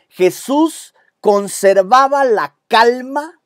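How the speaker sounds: slow, high dictation for notes. Shown as a demonstration. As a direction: spell it out slowly for the notes. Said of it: "Anote eso en tu bosquejo".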